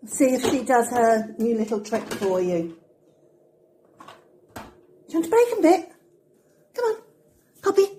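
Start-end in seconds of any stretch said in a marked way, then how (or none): 4.5-7.9 pet voice. Real, not acted. Coaxing Poppy into her trick, high pet voice. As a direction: dangle the treat with a high voice.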